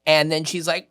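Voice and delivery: usual speaking voice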